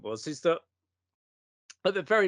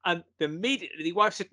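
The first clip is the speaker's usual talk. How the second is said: ordinary delivery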